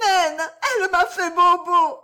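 put-on high, loud crying little girl; a child tearfully tells she was hurt; hurt child whimpering.

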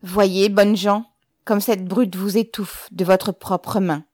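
loud, slow public appeal; hard true words. A narrator's reading. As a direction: address the crowd slowly and forcefully. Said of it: "le bombarda de ses paroles vraies et dures".

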